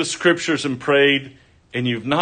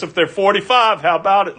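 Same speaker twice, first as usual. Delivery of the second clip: higher and faster, haggling with God; Abraham bargaining; 7 semitones higher, faster than usual. Acted. Abraham arguing with God for the city, quick haggling. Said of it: "he's arguing with God".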